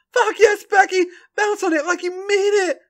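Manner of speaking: high, loud excited shout. A narrator's reading. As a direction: cheer her on at full voice. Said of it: "Charlotte shouts".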